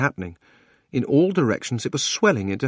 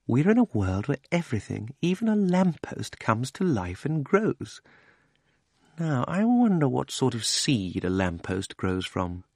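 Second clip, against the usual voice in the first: put-on wondering old uncle; 4 dB quieter than usual. A narrator's reading. Uncle Andrew's voice.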